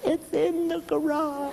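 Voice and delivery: high, slow, hushed wife voice; scared wife. Retold or imitated. Wife whispering to husband about the garage, high and scared. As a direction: breathe it out high and terrified.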